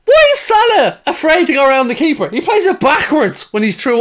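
high, laughing outburst; laughing disbelief. Real, not acted. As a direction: laugh through the exasperation.

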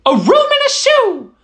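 high, delighted mouse cry; excited cry. Acted. Mouse finding the boot house, crying out with delight. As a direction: squeal it out with glee. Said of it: "cried the gray little mouse".

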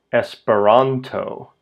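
"Esperanto" as es-pe-RAN-to, slowly; penultimate stress. Shown as a demonstration. Teacher giving the language's name, stress on RAN. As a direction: lean on the RAN in "Esperanto", slow and falling. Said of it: "next to the last syllable always gets the stress".

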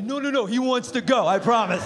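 mock insistence. Refusing the dog she hands over, mock insistence. Acts out pleading to hand off dog.

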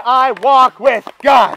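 slow, loud, high proclamation; proud declaration. Acted. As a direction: shout it out slowly with bold confidence. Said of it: "stick your head up high and say".

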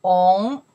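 On loud, rising from low; teacher's emphatic rising On; second tone. Shown as a demonstration. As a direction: slide On upward clearly. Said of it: "Second tone".

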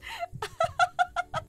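high, fluttery fangirl giggle; high giddy laughter. Real, not acted. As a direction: giggle helplessly at a high pitch. Giddy beside the crush.

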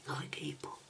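soft late-night whisper. Real, not acted. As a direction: whisper it through a hiccup.

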